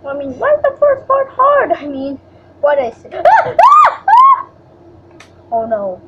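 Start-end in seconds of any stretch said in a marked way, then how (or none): 3.4-4.5 loud high panicked screams. Real, not acted. Screaming in the game.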